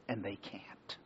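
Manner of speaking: a secret shared in a whisper. Real, not acted. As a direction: drop to an awed whisper. Awe at what angels cannot grasp.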